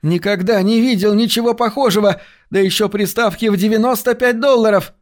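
high, breathless-with-news excitement; excited gabbling. A narrator's reading. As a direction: pour out amazing news in a high voice. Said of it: "затараторил он". (восторженная скороговорка, высоким голосом)

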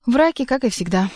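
grouchy dismissal; grumbling. A narrator's reading. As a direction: grumble it as a put-down. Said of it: "ворчала Азазелла".

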